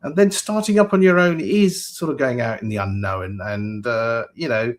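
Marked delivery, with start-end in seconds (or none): none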